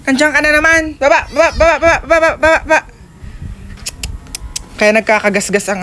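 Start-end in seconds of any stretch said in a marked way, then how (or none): 0.0-2.8 loud scolding shout; shooing cat. Real, not acted. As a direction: chase the cat off, yelling.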